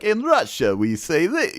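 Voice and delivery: mock Russian accent, high; hammy Russian wrestler voice. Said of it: "She does that voice all the time".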